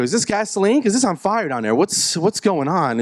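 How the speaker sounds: high, comic panic; alarmed complaint. Acted. Patient reacts to burning disinfectant.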